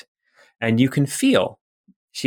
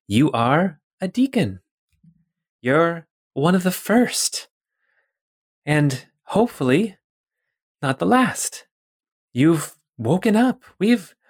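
higher and higher, thrilled; rising excitement; 6 semitones higher than usual. Acted. Scientist tells her creation it woke, growing thrill. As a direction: build the wonder as she explains.

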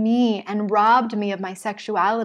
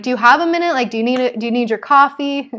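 higher, louder, sugary polite ask; sweetly asking; 3 semitones higher, 7 dB louder, much faster than usual. Retold or imitated. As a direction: ask gently, coaxing and eager. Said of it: "Before we unload on someone".

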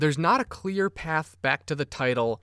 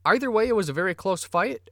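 normal talking voice

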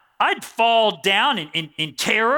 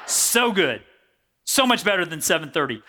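ordinary talk

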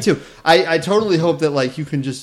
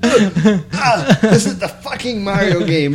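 high laughing voice; 7 semitones higher, much slower than usual. Real, not acted.

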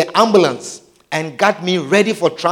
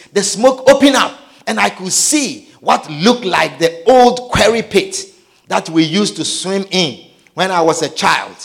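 normal talking voice

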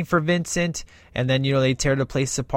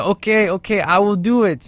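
higher, a little louder nervous Arnold; hammed-up nervous Arnold; 6 semitones higher, 3 dB louder than usual. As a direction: blurt it like a nervous new driver. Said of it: "like, driving".

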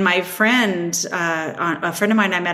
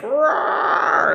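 a hint of a playful higher roar; mock monster roar; 7 semitones higher than usual. The Wizard of Oz guardian of her fears, mock roar. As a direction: lightly voice a guardian beast. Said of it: "Wizard of Oz type figure".

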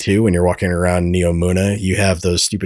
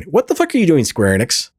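exasperated; 7 semitones higher than usual. Real, not acted. Exasperated rant at Square Enix.

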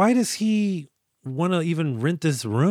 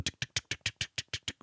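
soft, voiceless ticking of rotor blades; imitated helicopter blades; 13 dB quieter, much faster than usual. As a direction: tick softly like distant chopper blades. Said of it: "we hear some helicopter blades".

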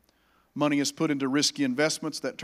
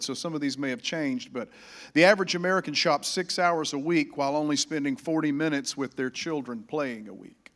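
usual speaking voice

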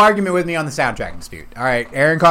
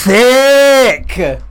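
drawn-out loud emphasis; emphatic; 8 semitones higher, 7 dB louder than usual. Real, not acted. Friend hyping a cartoon mom, loud drawn-out. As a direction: stretch the word out and lean in.

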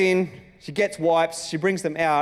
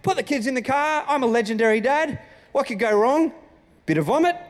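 cocky carefree dad; 8 semitones higher than usual. Retold or imitated. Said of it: "I just went".

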